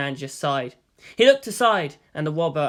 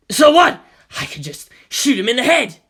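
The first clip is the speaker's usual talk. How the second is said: loud, high shout; robber's panicky threat; 8 semitones higher, 10 dB louder than usual. A narrator's reading. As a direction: cover fear with bluster.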